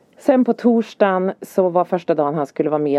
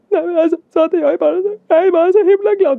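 high, hurried voice of happy crying; tearful joy; 12 semitones higher, 5 dB louder, faster than usual. Retold or imitated. Mother explains happy tears to son, tearfully overjoyed. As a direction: retell it as an overwhelmed, weepy mother. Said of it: "så jag börjar ju gråta".

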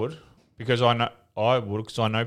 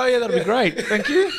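much higher, laughing mock gratitude; voice going up; 13 semitones higher than usual. Accepting a big paid offer, high laughing mock thanks. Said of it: "As your voice goes up".

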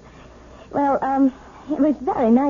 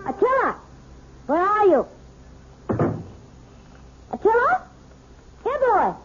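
calling the dog; 8 semitones higher than usual. Acted.

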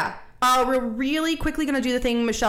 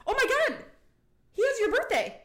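high excited gasp of discovery; surprised; 12 semitones higher than usual. Real, not acted. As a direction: blurt it out, thrilled. Discovers shared birthday.

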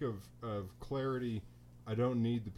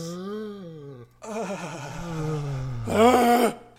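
long, loud exaggerated sigh of relief; loud collective sigh; 6 semitones higher, 12 dB louder than usual. Real, not acted. Hosts end the episode together, big loud sigh. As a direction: heave out a big theatrical sigh. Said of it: "breathe our collective sigh of relief".

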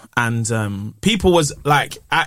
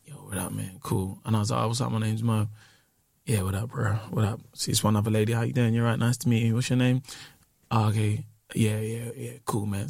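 cold low greeting; 6 semitones lower, 9 dB quieter than usual. Retold or imitated.

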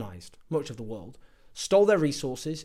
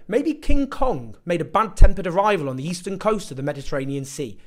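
sarcastic mockery; 4 semitones higher, 6 dB louder than usual. Sarcastic mock questions about cause.

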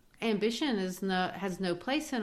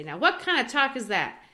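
higher and louder, chiding; mild scolding; 6 semitones higher, 7 dB louder than usual. Retold or imitated. Ruth scolding Mama for talking foolish, chiding. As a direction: tut at her like a scolding daughter-in-law.

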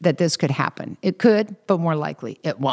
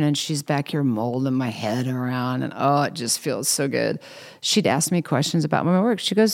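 ordinary talk

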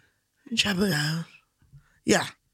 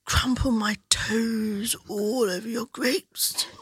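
breathy, seductive posh pitch to the sponsor, dropping to a whisper. Pretend. Podcast guest pitches Chapel Down in a mock posh seductive whisper. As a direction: purr it like a sultry posh lady selling wine. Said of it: "let me do my posh voice".

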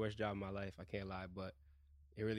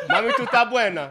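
laughing mock Spanish; 10 semitones higher, 10 dB louder than usual. Joking fake Spanish lines.

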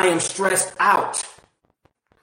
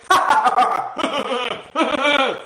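a short, bright laugh; demo laugh; 5 semitones higher than usual. Shown as a demonstration. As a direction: burst of laughter to prove the point.

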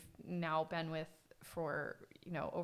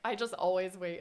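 ordinary talk, nothing marked

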